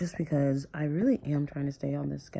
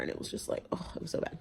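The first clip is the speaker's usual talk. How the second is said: whispered; 2 semitones higher, 9 dB quieter, faster than usual. Real, not acted. Recalling hospital loneliness.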